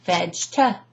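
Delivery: "Vegeta" as vej-tuh, two separate beats; "Vegeta" chopped, with no middle E; two isolated syllables. Shown as a demonstration. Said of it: "I break it down completely".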